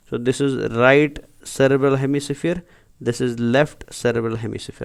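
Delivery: each word spelled out very slowly for notes; slow it right down so students can write; slow dictation. Shown as a demonstration. Teacher dictating labels slowly.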